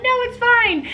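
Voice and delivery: high, loud, singsong brush-off; mock dismissive mom. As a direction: wave it away in a falsetto, playing innocent. Mom dismissing daughter's fear.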